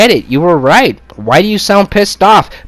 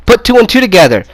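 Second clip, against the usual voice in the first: ordinary talk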